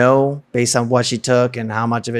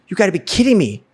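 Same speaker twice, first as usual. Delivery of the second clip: much higher-pitched incredulous exclamation; retold exasperated disbelief; 8 semitones higher, 3 dB louder, faster than usual. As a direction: act out utter disbelief. Retells disbelief at her threat.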